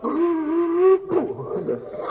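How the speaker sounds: loud high gasp like a gaping catfish mouth. Retold or imitated. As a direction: gulp the air like a huge catfish. Catfish mouths opening for hot dogs.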